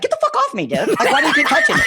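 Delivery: high, laughing mock grumble; annoyed shark voice. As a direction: voice the fed-up shark. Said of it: "the shark's just like".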